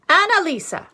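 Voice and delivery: put-on high loud call of the child's name. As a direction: call her sharply from afar. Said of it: "she cried".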